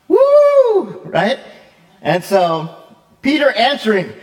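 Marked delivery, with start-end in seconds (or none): 0.1-1.0 very high, loud whoop; joyful woo. Real, not acted. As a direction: let out a big celebratory whoop. Preacher cheers the revelation.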